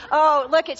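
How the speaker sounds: usual speaking voice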